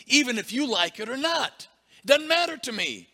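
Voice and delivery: loud, high defiant preaching. Real, not acted. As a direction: throw it at them.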